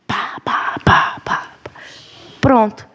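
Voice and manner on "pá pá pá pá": breathy imitated smacks of the switch; whispered spank sounds. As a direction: mimic the strokes in a soft whisper. (pá pá sussurrado imitando as varadas)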